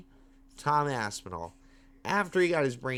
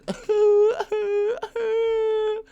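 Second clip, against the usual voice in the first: high wailing mock sob; mocking crying wail; 9 dB louder than usual. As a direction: wail like a crybaby fighter.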